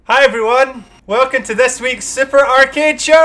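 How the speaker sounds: big high-energy show opener; loud, bright host welcome; exaggerated cartoon intonation. Show intro, host calling out.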